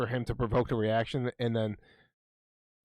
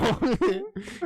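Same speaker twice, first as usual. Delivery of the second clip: cracking up at the story; high burst of laughter; 12 semitones higher, 4 dB louder than usual. Real, not acted. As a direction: laugh breaks out high and loud. Laughing at his own story.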